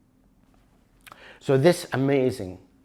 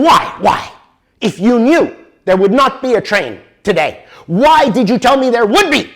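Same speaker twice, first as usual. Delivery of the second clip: much louder, higher, furious demand; retold angry shout; 9 semitones higher, 9 dB louder than usual. Traveler shouts at station clerk who misled him, retold angry yell. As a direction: yell at the clerk as if your plans were ruined. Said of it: "Now I'm getting really, really angry".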